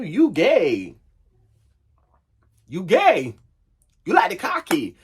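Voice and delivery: high, punchy mock declaration to oneself; emphatic self-confrontation. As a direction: call yourself out, high and emphatic. Acted blunt talk to himself.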